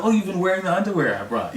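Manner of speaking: high, bright mimic of mother; mother's voice. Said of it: "And she's like".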